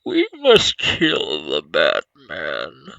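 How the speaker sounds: very low, slow, loud masked villain; Bane voice. Acted. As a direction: boom it out slowly like Bane. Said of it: "that was actually pretty good".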